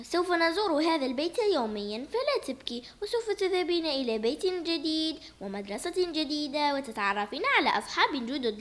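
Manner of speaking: usual speaking voice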